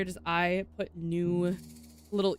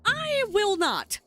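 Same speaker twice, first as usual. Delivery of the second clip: indignant refusal; 13 semitones higher, 5 dB louder, much slower than usual. Acted. Detective refuses the bank job.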